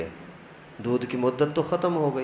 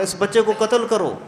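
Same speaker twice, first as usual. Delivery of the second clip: high-pitched shouted command, voice raised; چلا کر بولنا; 6 semitones higher than usual. A narrator's reading. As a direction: shout the order to kill the child. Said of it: "آواز زور سے چلا کر کے بولا". (چیخ کر حکم دینا)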